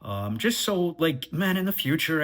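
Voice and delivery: ordinary talk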